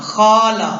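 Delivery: "खाला" with "खा" wrongly stretched; wrong long vowel. Shown as a demonstration. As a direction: draw out the first vowel of "खाला", as a mistake. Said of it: "गलत हो जाएगा".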